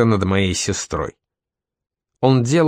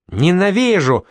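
shrieking; 8 semitones higher than usual. A narrator's reading. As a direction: break into a screech of hate. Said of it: "Эмбер сорвалась на визг".

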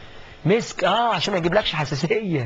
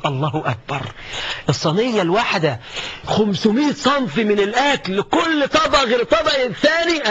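fiery preaching; 5 semitones higher than usual. Acted. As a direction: preach at full force, building excitement. Preacher building to fiery climax. (خطبة حماسية بصوت أعلى ونبرة مرتفعة)

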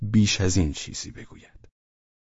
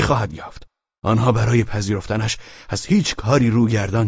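higher, eager persuasive man; voiced man persuading; 7 semitones higher, 6 dB louder than usual. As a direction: press the offer warmly, insistent. Velchaninov's dialogue in novel. (مردی مشتاق و مصر)

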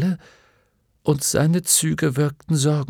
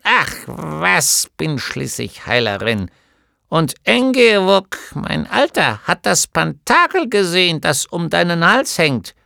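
put-on high, louder, impatient little old woman; brusque gnome healer; 4 semitones higher, 4 dB louder than usual. A narrator's reading. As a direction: prod the patient gruffly. (Heilerin fragt Atreju ungeduldig, höher und lauter)

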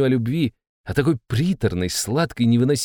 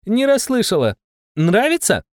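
much higher, loud, cheery call; cheerful shouting; 11 semitones higher, 4 dB louder than usual. Acted. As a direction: shout gaily over the noise. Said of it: "перекрикивая визг певицы, весело".

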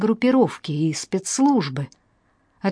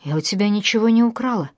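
ordinary delivery, nothing marked

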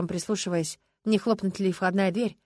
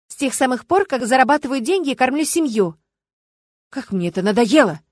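louder, exasperated retorts; quarrelling; 4 semitones higher, 7 dB louder than usual. Acted. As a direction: fire back in a marital row. Acted marital quarrel.

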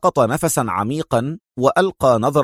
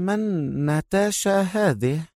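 slow, soft, higher drowsy murmur; sleepy mumble; 5 semitones higher, 6 dB quieter than usual. A narrator's reading. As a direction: mumble lazily, half asleep. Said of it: "مغمغمة باللغة الإسبانية وبصوت ملؤه التكاسل والخمول".

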